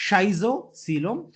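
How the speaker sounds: Schizocoelom drawn out slowly, rising at the end; slow demo of Schizocoelom; slow careful pronunciation. Shown as a demonstration. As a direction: model Schizocoelom slowly for the class. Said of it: "थोड़ा first one में थोड़ा difficulty is there".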